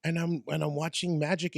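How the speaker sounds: ordinary talk, nothing marked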